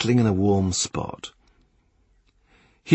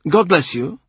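a lightly raised, higher voice for Gardiner; Gardiner's parting words; 9 semitones higher, 3 dB louder than usual. A narrator's reading. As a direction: toss off the blessing in a higher voice.

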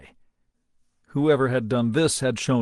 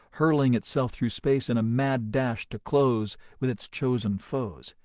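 ordinary delivery, nothing marked

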